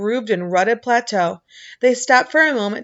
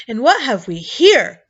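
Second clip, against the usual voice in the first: roaring; 4 semitones higher, 5 dB louder than usual. A narrator's reading. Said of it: "he roared".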